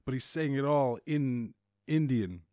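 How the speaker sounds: ordinary talk, nothing marked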